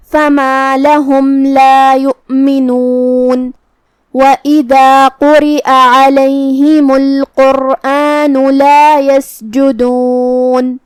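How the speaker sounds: slow chanted tajweed recitation; melodic, measured verse chant; Quran recitation. Acted. Teacher reciting verses for students, slow chant.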